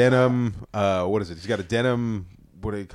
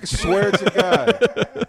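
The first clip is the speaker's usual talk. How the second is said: ordinary delivery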